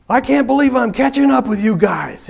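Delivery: high, loud hollering voice of a stranger. Retold or imitated. Biker hollering to the riders ahead, high and loud. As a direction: shout it like a thrilled stranger pedaling hard.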